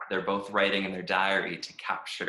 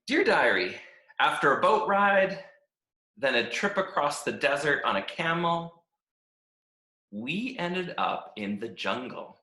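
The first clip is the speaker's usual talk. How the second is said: upbeat, chirpy diary reading; a happy-go-lucky stick who loves every minute; cheerful Stick; 7 semitones higher, 5 dB louder than usual. Acted. Stick reads his cheerful diary.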